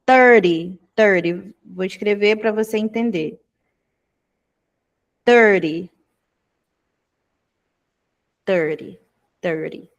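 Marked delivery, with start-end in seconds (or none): 0.0-1.5 "Thirty" said twice, flapped like "tedi"; pronouncing thirty. Shown as a demonstration. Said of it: "repetir a pronúncia de trinta". 5.2-9.9 "Thirty" said twice, flapped like "tedi"; pronouncing thirty. Shown as a demonstration. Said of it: "repetir a pronúncia de trinta".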